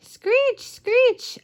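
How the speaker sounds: very high, shrieked screech screech; owl screech imitation. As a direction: shriek it like an owl waking the woods.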